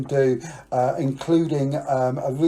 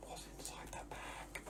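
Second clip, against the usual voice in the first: whisper; 27 dB quieter than usual. Real, not acted. Aside to camera.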